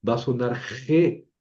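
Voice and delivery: G said as heh, with the Spanish J rasp; J-sounding G. Shown as a demonstration. Teacher warning what happens without U, G sounding as je. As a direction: turn G into je. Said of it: "Si yo no le pongo la U".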